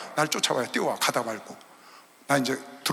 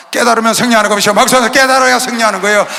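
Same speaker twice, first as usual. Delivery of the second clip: loud, driving pulpit declaration; forceful sermon cadence; loud preaching; 2 semitones higher, 11 dB louder than usual. Acted. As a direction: proclaim it to the church.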